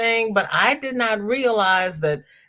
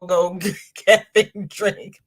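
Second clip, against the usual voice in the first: ordinary delivery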